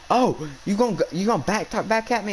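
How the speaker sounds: high-pitched imitation of an angry teacher; mock scolding. Teacher accusing a student of back talk, mock scolding. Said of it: "turned and started yelling at me".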